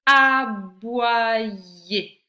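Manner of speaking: loud, long-held syllables in "Aboyer"; teacher slowly projecting "Aboyer" for careful listening. Shown as a demonstration. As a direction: draw "Aboyer" out, one syllable at a time. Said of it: "dans quelle syllabe tu entends".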